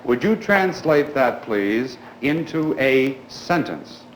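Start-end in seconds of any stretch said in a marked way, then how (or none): none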